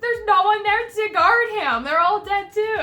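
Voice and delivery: high, loud exasperated whine; exasperated reply. Retold or imitated. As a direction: wail out the hopeless news. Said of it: "it's just like".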